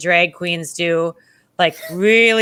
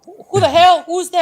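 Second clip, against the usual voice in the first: high, loud mock demand; pitched-up, impatient challenge; retold irritated shout; 8 semitones higher, 5 dB louder than usual. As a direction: imitate an annoyed man yelling through a door. Irritated challenge through hotel door.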